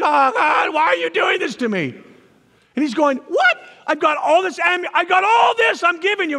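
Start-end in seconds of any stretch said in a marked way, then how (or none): 0.0-1.9 loud, high mock wail; anguished complaint. Believer complaining to God in difficulty, mock wailing. 3.2-6.4 high, loud incredulous outburst; exasperated disbelief. Acted. God answering the complainer, mock exasperated. Acting God's exasperated reply.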